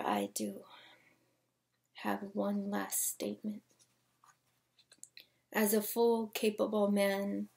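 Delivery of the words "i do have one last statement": hushed confiding whisper. Acted. Poet confiding to the audience in a whisper. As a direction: lean in and whisper it.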